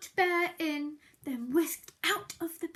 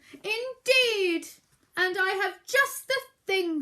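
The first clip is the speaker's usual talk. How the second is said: high, louder perky mouse voice; cheerful Mouse; 4 semitones higher, 7 dB louder than usual. Acted. Mouse offering Bear his remedy, perky and bright. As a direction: chirp it helpfully. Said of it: "said Mouse".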